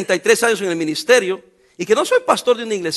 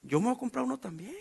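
a hint of a grumbling envious brother, much softer; envious church member; 4 semitones higher, 14 dB quieter than usual. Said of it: "Porque es decir".

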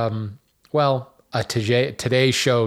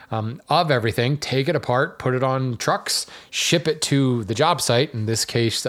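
ordinary talk

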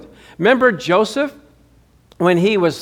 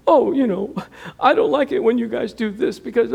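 mock whiny imitation; 6 semitones higher than usual. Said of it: "imitating him".

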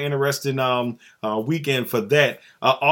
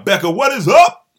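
higher, louder greeting shout; calling out hello; 9 semitones higher, 11 dB louder than usual. Real, not acted. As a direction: call her name across the room. Calling out to a guest.

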